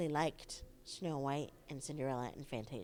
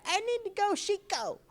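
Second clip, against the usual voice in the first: put-on little kid voice; 13 semitones higher, 7 dB louder than usual. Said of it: "I'd be like".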